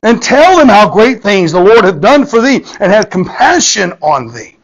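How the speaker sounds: high, loud preaching read; scripture reading. Real, not acted. Preacher reads the Gospel to the congregation, high and loud. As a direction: proclaim the verse in a high, ringing voice.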